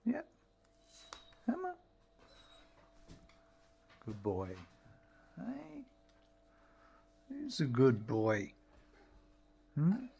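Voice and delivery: cooing to a pet. Real, not acted. Owner coaxing his cat Randy onto his lap, cooing. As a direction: coax him up like a pet.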